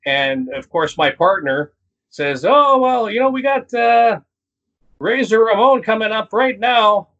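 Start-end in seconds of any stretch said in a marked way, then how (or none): 2.5-7.1 wrestling commentator. Retold or imitated. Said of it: "wrestling announcer voice coming".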